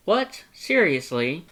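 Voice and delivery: high, loud disbelief; shocked skeleton can't believe it; incredulous. Acted. Papyrus shocked by blank form.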